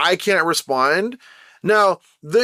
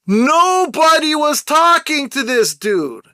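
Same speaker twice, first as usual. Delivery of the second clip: raised voice, pitched high and slowed down; real yelling; 7 semitones higher, slower than usual. Real, not acted. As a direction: yell it out, stretching the words. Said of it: "You see, I'm yelling now".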